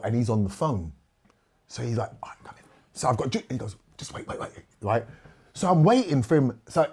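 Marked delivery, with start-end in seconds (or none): 2.2-2.6 much softer, hushed aside while on the phone. Retold or imitated. 4.0-4.5 whispered urgent wait. Retold or imitated. Uncle shushing him during call.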